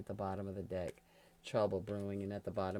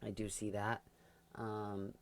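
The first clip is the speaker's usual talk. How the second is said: ordinary delivery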